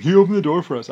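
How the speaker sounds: scornful mimicry of two rivals; Rodney and Rick Bush. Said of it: "Rodney and Rick Bush were like".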